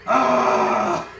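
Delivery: mock scream. Scream at ghost.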